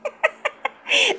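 squealing high laugh; real giggle at the comment. Real, not acted. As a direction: let a high giggle escape.

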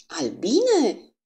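a high, shouted cry of alarm. Acted. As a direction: cry out the word in shock. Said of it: "strigă Poirot din nou".

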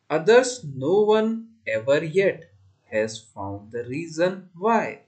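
measured verse in short, separated phrases; poem recitation. Shown as a demonstration. Teacher reciting the last stanza to pupils, measured recitation. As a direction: recite it carefully, pausing after each phrase.

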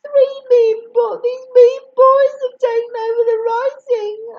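sobbing. A narrator's reading. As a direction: wail through the tears. Amy sobbing complaint.

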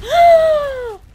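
loud, high gasp of alarm; shocked gasp. Real, not acted. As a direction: gasp sharply in disbelief. Creature hits the mount.

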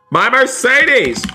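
high, drawn-out wail of dismay; dismayed outcry. Pretend. Gamer seeing the Mercedes crashed, dismayed outcry. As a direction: cry out slowly, pitch soaring then falling.

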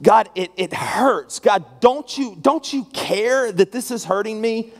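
high, forceful cry to God; pained plea. Acted. Honest believer asking God that question, acted pained plea. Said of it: "you're asking that question to God".

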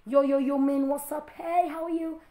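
lightly voiced slangy American greeting; mock new accent. Pastor mocking the trendy new African accent, lightly voiced. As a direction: toss off a hint of a hip street accent. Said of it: "Is the new accent".

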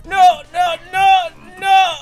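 panicked shriek. Real, not acted. Panicked failing a jump.

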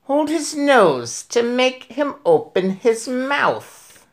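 high, slow witchy hiss of a voice; high slow witch voice, savouring it. Acted. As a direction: play the Grand High Witch gloating over her victim. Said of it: "and she said".

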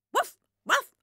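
high yappy woof woof; dog barking. A narrator's reading. Narrator barking as the dog for the children. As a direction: bark it out like a small excited dog. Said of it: "roept Takkie weer".

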